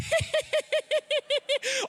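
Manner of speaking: very high mock cackle; wife's laugh through door. Husband imitates wife laughing behind the bathroom door, high shriek. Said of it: "all I can hear is".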